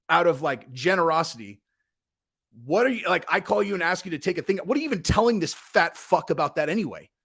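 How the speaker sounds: normal talking voice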